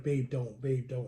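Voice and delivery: low, soft attempt to calm someone; quiet pleading. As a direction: imitate talking a partner down under your breath. Holding back partner about to snap.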